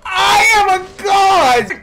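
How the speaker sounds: high, slow, loud god-like boast; triumphant yell. Real, not acted. Gamer boasts after a great play, triumphant shout. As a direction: roar it in hammed-up triumph.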